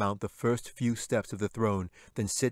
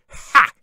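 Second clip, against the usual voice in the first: sharp high jeering cackle; a shrill goblin's mocking laugh; 17 dB louder than usual. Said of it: "and laughed".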